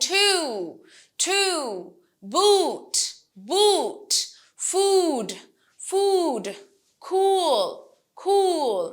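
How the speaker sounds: TWO, BOOT, FOOD, COOL with a drawn-out oo; long vowel. Shown as a demonstration. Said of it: "long vowel".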